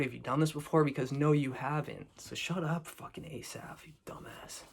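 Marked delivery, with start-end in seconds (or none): 2.2-4.6 whispered, scornful jab at Asaph; muttered insult. Real, not acted. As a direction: hiss the insult under your breath. Insulting the contestant under breath.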